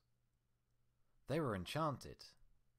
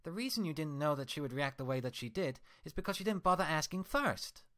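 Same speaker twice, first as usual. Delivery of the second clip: higher, louder furious scolding; angry Twilight; 9 semitones higher, 7 dB louder than usual. Acted. Twilight scolding her mirror twin, furious and loud. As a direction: tear into her reflection with rage.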